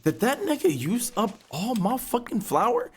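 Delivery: high, incredulous outraged question; can't believe he used it all; disbelieving outrage. Retold or imitated. Quoting himself discovering roommate used up his flour, high and outraged. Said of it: "I'm like".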